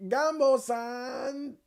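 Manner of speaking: "Gumbo san!" high and slow, still off; high drawn-out call. Shown as a demonstration. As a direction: pull "Gumbo san!" long at a high pitch. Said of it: "I can't get the right pitch".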